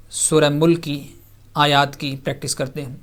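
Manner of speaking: ordinary delivery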